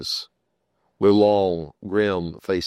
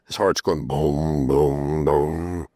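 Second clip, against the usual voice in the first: heartbeat boom; 4 semitones lower, much slower than usual. Retold or imitated. Imitates heartbeat in story.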